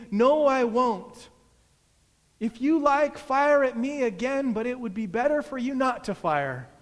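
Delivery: high, loud defiance; shouted refusal. A narrator's reading. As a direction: shout back, easing slightly at the end. Shouted answer to yelling adversary.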